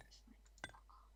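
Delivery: under the breath, whispered; whispered swear aside. Retold or imitated. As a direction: hiss it so no one hears. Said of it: "I'm like".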